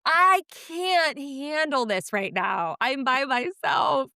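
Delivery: overwhelmed wail. Retold or imitated. Mom alone with a toddler who just falls, overwhelmed wail. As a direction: wail it slowly, at breaking point. Overwhelmed parent voiced at breaking point.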